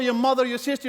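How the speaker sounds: normal talking voice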